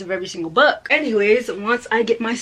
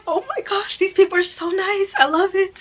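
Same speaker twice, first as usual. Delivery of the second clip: gushing; 9 semitones higher than usual. Retold or imitated. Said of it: "I was like".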